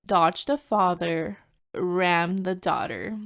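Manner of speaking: slow, spaced-out reading of a slogan; measured, deliberate quote; deliberate readout. Retold or imitated. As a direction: read it out word by word, slowly. Reads out the offending license plate.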